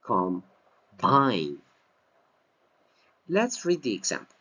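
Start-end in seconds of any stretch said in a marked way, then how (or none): 0.0-1.6 "Combine" with low "com", strong falling BINE; stress on second syllable. Shown as a demonstration. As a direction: punch BINE in "Combine" after a light "com". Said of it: "as a verb".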